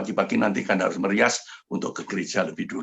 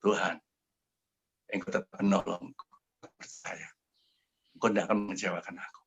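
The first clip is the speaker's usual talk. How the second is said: soft, subdued plea to God; understated retold crying, low volume; retold tearful prayer; 3 semitones lower, 7 dB quieter than usual. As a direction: pray quietly, as if worn out.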